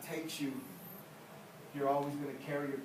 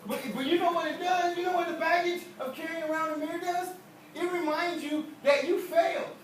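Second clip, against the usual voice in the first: preaching; 11 semitones higher, 7 dB louder than usual. Acted. Preacher presses the congregation about guilt, high loud preaching. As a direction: drive it home from the pulpit.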